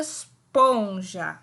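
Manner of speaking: Esponja stretched out, low; very slow. Shown as a demonstration. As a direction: draw out Esponja slowly. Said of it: "repitam bem devagar".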